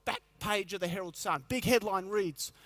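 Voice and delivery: normal talking voice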